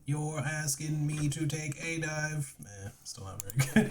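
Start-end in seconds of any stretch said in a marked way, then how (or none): 0.1-2.5 slow, higher put-on Cockney; a lightly voiced Cockney heavy; mock Cockney, drawn out; Cockney accent. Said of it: "how do I make these two accents sound right?".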